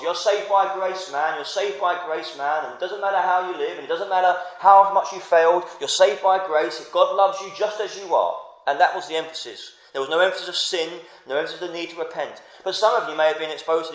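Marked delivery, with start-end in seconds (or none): 0.0-8.4 breezy "saved by grace, man" voice; mock easy-grace preacher. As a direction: lightly parody the careless preaching. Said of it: "in my background, it was".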